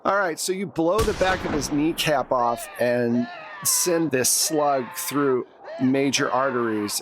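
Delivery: ordinary delivery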